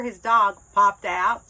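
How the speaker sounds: ordinary delivery, nothing marked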